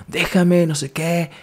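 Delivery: high-pitched retold yell; frantic cry, not at full volume; mock shouting. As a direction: imitate someone yelling, pitched well up. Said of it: "Pero él estaba gritando".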